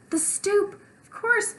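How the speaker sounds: high sudden realization. Acted. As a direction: it just clicked.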